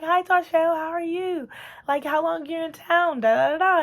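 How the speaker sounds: high, bright-eyed gush of welcome; excited greeting. Retold or imitated. Family friend greeting Tasha at church, high excited welcome. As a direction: mimic a delighted old family friend at the door.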